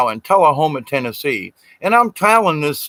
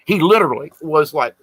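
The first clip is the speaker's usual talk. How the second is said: ordinary talk, nothing marked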